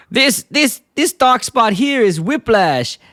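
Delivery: high-pitched mock doctor, drawn-out key words; Doctor Nick. Quack doctor reads an x-ray to the family, high mock-authoritative voice. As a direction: play a quack doctor pronouncing a diagnosis. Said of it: "and Doctor says".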